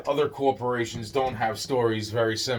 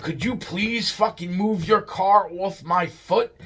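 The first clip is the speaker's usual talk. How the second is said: high, slow exasperated demand; retold angry yell; 7 semitones higher than usual. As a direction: imitate a mad man spelling it out. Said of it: "he's really fucking mad. He's yelling".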